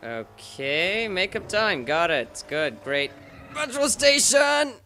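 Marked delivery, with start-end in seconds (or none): 3.5-4.7 high, loud, drawn-out call; sudden excited shout. Pretend. Player spots a petrol station, sudden high shout. Sudden outburst at a game sight.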